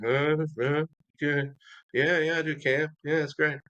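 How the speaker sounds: high, dazed mock-groggy answers; half-asleep self. Woken for phone interview, high dazed mock replies.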